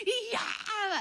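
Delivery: high crying shout; shouting in tongues. Retold or imitated. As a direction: cry it out loud in tongues. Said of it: "así de".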